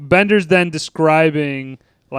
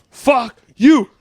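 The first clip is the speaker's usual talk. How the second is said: higher, louder fuck you; a father cursing his son a second time; dad cursing louder; 9 semitones higher, 5 dB louder than usual. Retold or imitated. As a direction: snap it back harder. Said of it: "Oh, mine? It's real easy".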